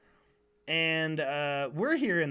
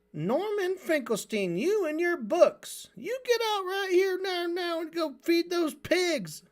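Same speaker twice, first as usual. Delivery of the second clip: much higher shrill scolding mother voice; nagging housewife; 11 semitones higher than usual. Retold or imitated. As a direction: scold a bookish boy like a fed-up farm mom. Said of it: "a housewife version of Steve Bunnell".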